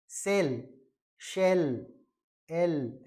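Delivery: "सेल, शेल, एल" one by one with an open e; vowel sound demo. Shown as a demonstration. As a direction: isolate each of "सेल, शेल, एल" with pauses. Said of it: "पर ये ए है".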